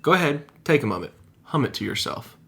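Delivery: upbeat host read; video narration. Acted. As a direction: read it like a show intro. Scripted video intro read.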